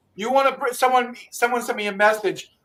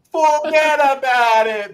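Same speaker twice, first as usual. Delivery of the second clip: forget about it drawn out high and slow; slow thick New York catchphrase; New York accent; 5 semitones higher, 5 dB louder, slower than usual. Shown as a demonstration. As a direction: ham up the Brooklyn way. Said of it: "you gotta say it right".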